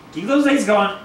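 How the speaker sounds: loud, upbeat coaxing; excited encouragement. Real, not acted. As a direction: urge the little one on. Cheering baby's steps.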